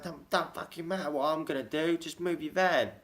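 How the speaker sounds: high, irate Cockney neighbour; high-pitched mock fury; mock Cockney rant. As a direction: imitate a furious neighbour's put-on Cockney. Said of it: "he puts on a Cockney accent".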